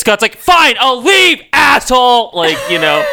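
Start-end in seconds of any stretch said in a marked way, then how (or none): 0.4-2.3 drawn-out, high, raised-voice huff; mock indignant outburst. Scott leaving after an awkward hookup, mock indignant. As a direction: storm off in a loud, hammed-up huff.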